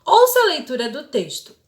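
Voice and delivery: usual speaking voice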